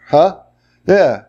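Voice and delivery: normal talking voice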